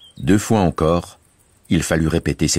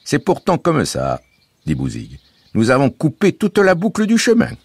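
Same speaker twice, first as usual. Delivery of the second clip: put-on higher, lively voice for the boy Bouzig; Bouzig's voice; 11 semitones higher, 4 dB louder than usual. A narrator's reading. Bouzig reassuring the family, bright boyish voice. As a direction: play the cheerful young guide Bouzig.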